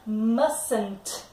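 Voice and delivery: Mustn't said as MUS-ent, the T in the middle silent; model pronunciation. Shown as a demonstration. Teacher to class: Mustn't said MUS-ent, silent middle T. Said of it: "a pronúncia".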